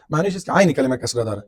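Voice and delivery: ordinary talk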